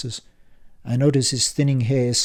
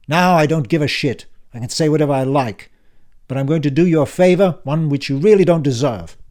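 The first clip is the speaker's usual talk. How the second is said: put-on louder, higher, impatient old man; brusque uncle Frank; 4 semitones higher, 7 dB louder than usual. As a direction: bark it like a testy uncle back from the dead. Frank's instructions.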